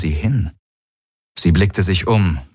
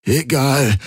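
higher, strained croak; 8 semitones higher than usual. A narrator's reading. Injured man dismisses question of where, croaking. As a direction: croak it out with effort. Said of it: "krächzte Garland".

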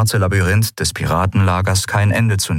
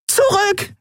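high panicked shout; panicked scream. A narrator's reading. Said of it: "schrie sie panisch".

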